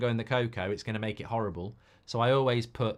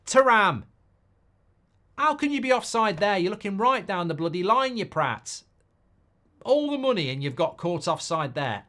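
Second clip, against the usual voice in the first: much higher, louder rant at the player; furious at the TV; real exasperated shouting; 8 semitones higher, 7 dB louder than usual. Real, not acted. Raging at offside in live match.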